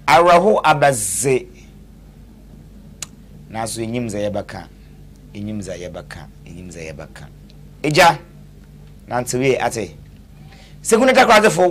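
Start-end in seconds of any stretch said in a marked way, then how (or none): 3.5-7.3 fading echo. Acted. Repeated line fading away.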